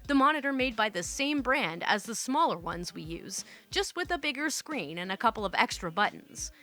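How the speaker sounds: ordinary delivery, nothing marked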